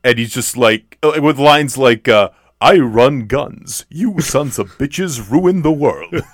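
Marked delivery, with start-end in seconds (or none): 2.6-6.2 slow, hammed-up tough-guy line; mock overacted menace, drawn out; overacting tough guy. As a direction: ham it up like a B-movie hero, slowly. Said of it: "just chewing it up and just overacting".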